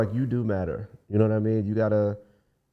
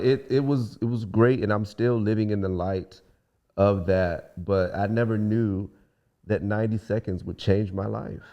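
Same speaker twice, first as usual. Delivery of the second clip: ordinary delivery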